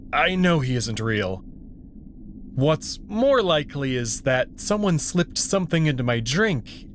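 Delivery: plain reading voice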